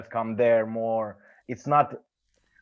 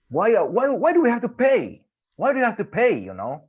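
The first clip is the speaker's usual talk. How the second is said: higher and louder, exasperated why; heated rant; 5 semitones higher, 4 dB louder than usual. Real, not acted. Friend ranting about paying for tests, higher exasperated. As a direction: demand why, fed up and rising.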